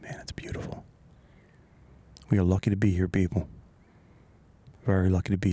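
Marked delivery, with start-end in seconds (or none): 0.0-0.8 hushed whisper of awe. Real, not acted. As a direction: breathe it out softly. Quiet awe in nature.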